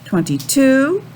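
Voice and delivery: normal talking voice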